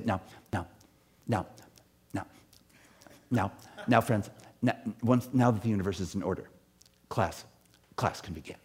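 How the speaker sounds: lightly imitated soft-spoken professor; sparse, hushed words with long pauses between; quiet teacher. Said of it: "talked in very quiet voices".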